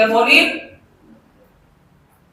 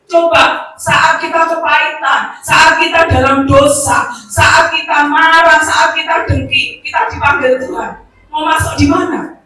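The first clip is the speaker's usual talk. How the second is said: preaching at full force; 5 semitones higher, 7 dB louder than usual. Acted. Preacher warning the congregation, loud and rising. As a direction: press the warning home to the church.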